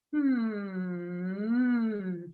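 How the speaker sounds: long hum dipping and rising. Shown as a demonstration. Coach circling the ankle, long hum. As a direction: glide a closed-lip hum down and up. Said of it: "with a hum".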